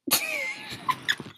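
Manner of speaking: high wheezy laugh; real high-pitched laughter; laughing fit. Real, not acted. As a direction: crack up helplessly at your own joke. Cracks up at own joke.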